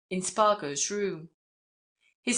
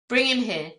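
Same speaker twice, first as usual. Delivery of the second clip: louder, higher-pitched order; raised, commanding voice; 4 semitones higher, 6 dB louder than usual. A narrator's reading. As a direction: give it as a firm command. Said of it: "commanded Spargo".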